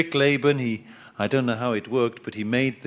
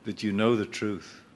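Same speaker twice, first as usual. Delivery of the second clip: softer, lower, gentle rebuke; God's quiet reply; 4 semitones lower, 6 dB quieter than usual. Retold or imitated. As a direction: calmly set him straight. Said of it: "And God says".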